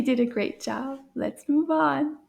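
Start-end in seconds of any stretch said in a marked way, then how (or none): none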